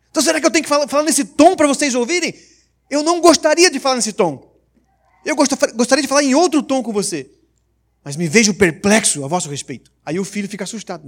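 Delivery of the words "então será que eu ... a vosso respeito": high, loud stern correction; a father warning he will not repeat himself; energetic fatherly rebuke. Retold or imitated. Paul as father scolding his children, loud and high. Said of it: "o pai fala de forma enérgica".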